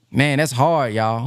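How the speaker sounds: ordinary delivery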